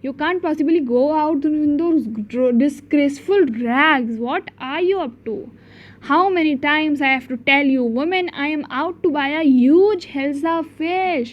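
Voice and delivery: reads each English word slowly, spelling it out for the class; carefully paced read-aloud for children; slow storybook reading. Acted. Teacher reading the story's English lines to the class, slow and deliberate.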